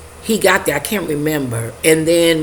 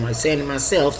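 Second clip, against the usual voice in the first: ordinary talk, nothing marked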